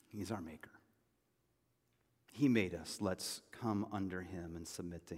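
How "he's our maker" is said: "He's our maker" breathed in a real whisper. Real, not acted. Pastor to congregation, a hushed real whisper. Repeated line, second whispered.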